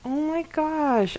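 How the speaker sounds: ordinary talk, nothing marked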